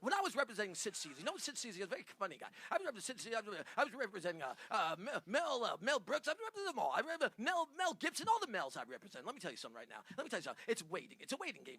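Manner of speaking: brash, booming fast-talking agent. Pretend. Prank caller booming at Kevin as a blustering agent. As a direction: bark it like a pushy Hollywood agent. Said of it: "Do it, do it as Brian".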